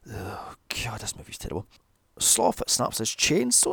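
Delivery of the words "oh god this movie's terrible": soft, breathy whisper of despair; muttered groan of defeat. Real, not acted. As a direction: whisper it as if losing the will. Weary aside about movie.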